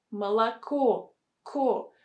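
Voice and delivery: "Молоко" as ma-la-KO, then "Ко" alone; weight on the final syllable of "Молоко", repeated as "Ко"; stress on last syllable. Shown as a demonstration. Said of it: "stress on the last syllable".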